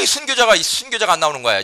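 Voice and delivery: ordinary talk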